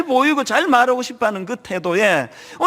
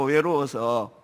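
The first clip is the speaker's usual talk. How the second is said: 외로워서 repeated much lower and softer; quiet echo; 9 semitones lower, 6 dB quieter, slower than usual. Acted. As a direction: drop to a soft, low 외로워서. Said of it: "외로워서 어떻게 사실래요". (외로워서를 낮고 조용히 되묻기)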